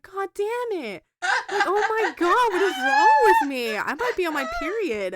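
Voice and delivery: hammed-up tearful whine breaking toward laughter; mock tearful outburst. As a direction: replay your weepy meltdown, loud and overblown. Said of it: "I start tearing up too".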